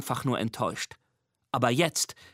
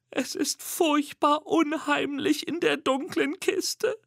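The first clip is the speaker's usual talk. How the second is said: squeaky monster whisper; 13 semitones higher, slower than usual. A narrator's reading. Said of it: "flüstert Mimi".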